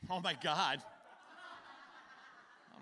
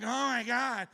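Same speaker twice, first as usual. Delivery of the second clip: groaning in pain; 7 semitones higher, 7 dB louder, slower than usual. Retold or imitated. In pain on toilet.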